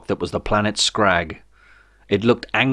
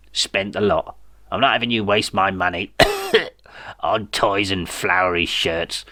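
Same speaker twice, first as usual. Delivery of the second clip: dying evil dictator. A narrator's reading. Rancid's last instructions.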